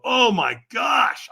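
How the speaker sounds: drawn-out, high exclamation; sudden alarm. Retold or imitated. Fire walker suddenly thinking feet are burnt, alarmed cry.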